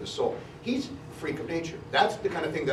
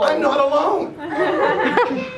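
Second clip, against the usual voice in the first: Pillsbury Doughboy; 13 semitones higher, 11 dB louder, much slower than usual. Retold or imitated. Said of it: "he was also the little giggle of the Pillsbury Doughboy".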